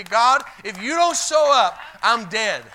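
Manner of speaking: loud desperate prayer, nothing left; desperate cry. Acted. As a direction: cry out to God like your life hangs on it. Desperate prayer voiced in sermon.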